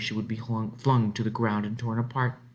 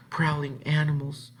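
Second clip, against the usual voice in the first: usual speaking voice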